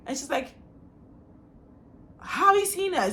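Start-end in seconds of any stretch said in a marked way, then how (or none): 2.2-3.1 high-pitched, retold disbelief; startled question. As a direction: imitate someone caught out, pitched high. Girl caught out, retold.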